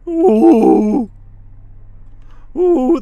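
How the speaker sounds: high, loud drawn-out "ooh"; loud high whoop of surprise; startled "ooh". Real, not acted. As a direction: let out a sudden high "oooh". Startled yelps while playing spooky game.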